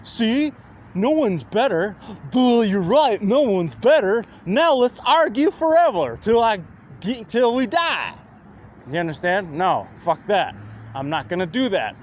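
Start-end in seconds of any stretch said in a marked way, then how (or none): 0.0-8.1 higher, louder dopey mimicry of critics; dumb mocking voice. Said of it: "Just so you can go".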